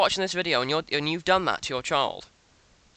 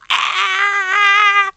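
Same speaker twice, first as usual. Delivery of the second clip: shrill mock toddler wail, much higher and louder; imitated tantrum shriek; toddler meltdown scream; 12 dB louder than usual. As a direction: scream like a kid whose iPad was taken. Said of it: "they go".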